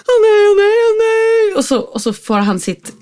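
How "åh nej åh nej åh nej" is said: high-pitched, loud "oh no" wail; mimicked panicked protest, high and loud; imitated distressed wailing. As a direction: wail it like a child starting a meltdown. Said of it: "Och då börjar Frans så här".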